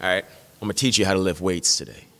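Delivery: a hint of a patient father teaching his son; dad voice. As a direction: son hears dad start the lesson, low and easy. Dad voiced in childhood story.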